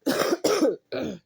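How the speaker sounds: a loud, high burst of real laughter; loud helpless laugh at the interruption; exasperated laugh. Real, not acted. Laughs at son interrupting recording.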